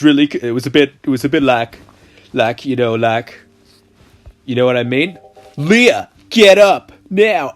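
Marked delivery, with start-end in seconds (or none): 5.6-7.6 loud, high heroic call; heroic rescuer voice. Acted. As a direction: rouse her like a storybook hero. Brother rousing sleeping sister.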